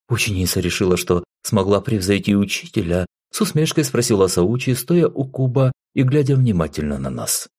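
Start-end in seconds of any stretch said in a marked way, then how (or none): none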